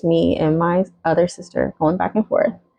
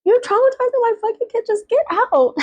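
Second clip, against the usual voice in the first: much higher and fast, imitated fury; high furious yell. Retold yelling at sister.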